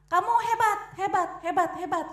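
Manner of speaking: high loud praise fading in repeats; echo. Retold or imitated. The echo returning the praise, high and fading. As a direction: let hebat ring back and fade. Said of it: "ngomongnya apa?".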